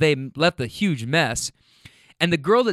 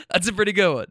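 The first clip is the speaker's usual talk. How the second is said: ordinary talk, nothing marked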